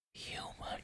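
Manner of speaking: a single whispered word, almost breath; the king whispering his discovery into her ear; menacing whisper. Acted. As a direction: let it slip out as a secret. Revealing her as human.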